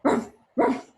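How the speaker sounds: the dog joins in calling, bark. Acted. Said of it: "called her dog".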